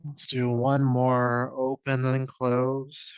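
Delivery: stretched slow yoga cue; slow guided cue. Acted. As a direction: draw it out calmly.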